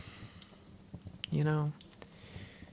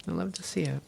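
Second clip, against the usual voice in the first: ordinary talk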